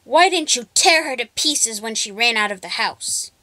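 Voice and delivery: loud furious demand; angry yelling. Acted. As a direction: rage at the dog. Witch yells at dog.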